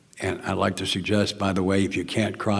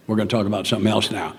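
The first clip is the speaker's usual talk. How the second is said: ordinary talk, nothing marked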